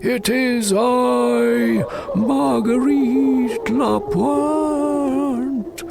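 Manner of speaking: very slow, high, eerie lady ghost; ghostly wailing. Acted. Cousins torment Gamaliel, slow high ghost wail. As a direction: drag it out like a drowned woman's spirit. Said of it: "calling, wailing".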